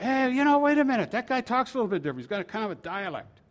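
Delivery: high-pitched acted onlooker voice; suspicious bystander. Bystanders noticing Peter's dialect, high-pitched suspicion. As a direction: pipe up high as someone just noticing. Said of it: "bystanders are starting to say".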